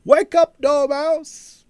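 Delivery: very high, loud wake-up shout; shouting Wake up Dormouse. A narrator's reading. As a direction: yell into a sleepy ear. Pinching the sleeping Dormouse.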